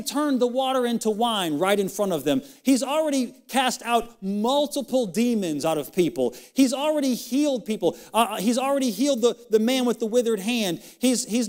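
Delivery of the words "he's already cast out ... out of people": ordinary talk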